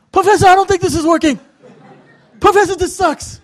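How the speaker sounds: high, loud, hurried complaint; mock distressed whining yell. Student getting choked on the floor, mock desperate yell. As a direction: cry out like you're getting choked, hammed up.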